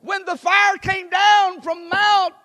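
high, loud despairing cry to God; Elijah crying out. Retold or imitated. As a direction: shout the prophet's lament up to heaven. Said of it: "Elijah's saying".

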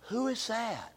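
very soft, breathy question; quietly, struck by the sight of her; hushed wonder. Retold or imitated. Boaz spotting Ruth in the field, hushed and struck. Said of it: "I can hear him say".

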